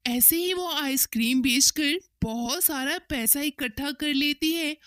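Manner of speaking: ordinary delivery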